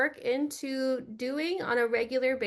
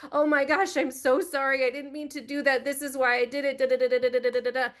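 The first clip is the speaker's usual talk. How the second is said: overdone rambling excuse trailing off into da da da; mock gushing apology; faster than usual. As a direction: gush out sorry after sorry, half-mocking. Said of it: "big, long epitaph of like".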